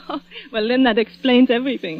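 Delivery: light laugh on the words. Acted. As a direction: chuckle as it dawns on you. Fifi laughs realizing.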